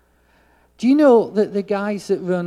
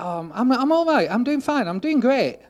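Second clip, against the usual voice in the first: lightly voiced woman, pitched higher and breezy; a hint of an overly chirpy lady brushing it off; mock cheerful lady; 7 semitones higher than usual. Pastor imitating the lady he met, higher and breezy. Lady's reply quoted in story.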